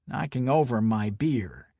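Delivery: ordinary delivery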